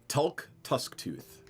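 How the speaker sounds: spelled out slowly, each syllable clear. Shown as a demonstration. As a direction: say Tulk Tusktooth slowly for the player. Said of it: "What's his name again?".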